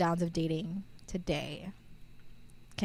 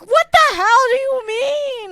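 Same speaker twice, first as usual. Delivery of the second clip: much higher and louder, slow outraged yell; outraged shout; 14 dB louder than usual. Retold or imitated. Retelling the date to a friend, an outraged shout. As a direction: yell it in disbelief at him. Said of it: "I'm like".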